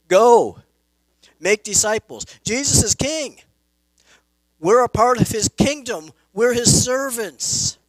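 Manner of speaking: loud, forceful preaching of a royal command; emphatic loud command. Real, not acted. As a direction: bark it out as marching orders from the pulpit. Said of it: "it's very emphatic".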